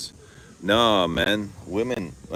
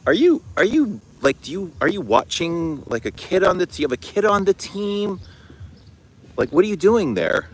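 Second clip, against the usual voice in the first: higher, prying questions in a hammed-up voice; mock nosy staffer; 13 semitones higher, 5 dB louder than usual. Team parent quizzing the man hiding in the bushes, higher mock voice. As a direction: play the suspicious parent who found him in the bushes. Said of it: "and they're like".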